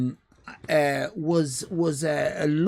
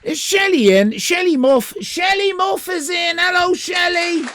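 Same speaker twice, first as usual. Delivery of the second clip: excited greeting; 8 semitones higher, 6 dB louder than usual. Real, not acted. Greeting a viewer.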